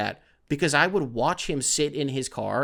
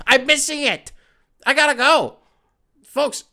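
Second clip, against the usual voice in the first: much higher and louder, rising panic over missing the show; frantic excitement; 9 semitones higher, 6 dB louder than usual. Real, not acted. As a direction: yelp it out like the show is happening without you. Panics about missing First Take.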